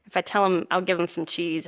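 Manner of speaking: normal talking voice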